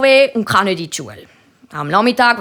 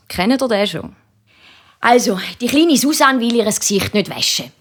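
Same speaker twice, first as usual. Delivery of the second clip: plain reading voice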